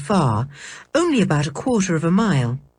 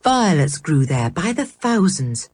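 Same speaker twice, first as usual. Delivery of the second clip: plain reading voice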